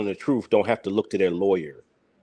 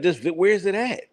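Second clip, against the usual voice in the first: pitched up, quick quoting of the reporter; excited mock reporter; 8 semitones higher than usual. Vlogger mimics the reporter's demand, pitched up. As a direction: toss the question like a pushy reporter. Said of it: "He's like".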